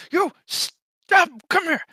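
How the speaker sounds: high-pitched, loud command; bossy goblin. Acted. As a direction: order them over in a high goblin voice. Goblin orders the tall one.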